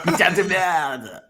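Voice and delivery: French swearing, slow and mocking; French insult. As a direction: spit it out like an angry Frenchman. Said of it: "throws insults at him in French".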